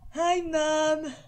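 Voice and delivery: put-on loud, high silly goofy kid; derpy kid voice. Young Agir happily shouting hello to his mum, derpy. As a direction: greet mum dopey and happy. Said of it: "a silly sort of derpy type voice".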